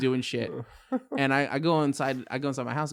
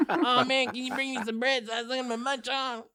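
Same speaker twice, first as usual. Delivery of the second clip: whiny needy friend; 10 semitones higher than usual. Retold or imitated. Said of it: "And he's like".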